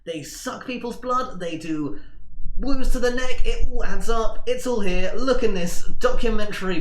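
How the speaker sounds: high, building to loud eager nerd; nerdy voice. Acted. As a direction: lecture with rising excitement. Milton explains vampires.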